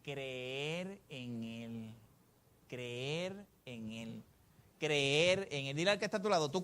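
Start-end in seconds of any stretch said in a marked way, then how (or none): 0.0-4.2 softer, lower and very slow; hushed, slow. Shown as a demonstration. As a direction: draw each word out almost under your breath. Preacher repeats phrase for congregation.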